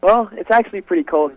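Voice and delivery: ordinary talk, nothing marked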